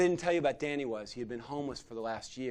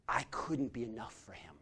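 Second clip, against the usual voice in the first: hushed whisper of regret; whispered confession; 5 semitones lower, 10 dB quieter than usual. Real, not acted. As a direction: whisper it like a painful admission.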